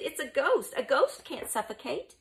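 high, loud insistence; insistent. A narrator's reading. Antonius contradicts Mucius, insistently. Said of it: "Antonius insisted".

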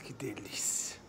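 breathy whisper of relief; satisfied whisper after sneezing; whispered delight. Real, not acted.

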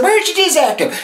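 high, breathless squeak; breathless ask. Retold or imitated. Shopper to clerk, high breathless question. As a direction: gasp it out high after running. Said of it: "I was out of breath and I said".

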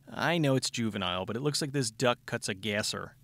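ordinary talk, nothing marked

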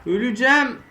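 high loud panic; panicked yell. Real, not acted. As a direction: scream as you're about to die. About to die. (panik çığlığı)

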